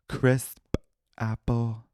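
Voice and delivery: crisp with a puffed final P, apple with a popped P; p popped at word end. Shown as a demonstration. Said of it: "every time I say a P at the end of a word, I add a".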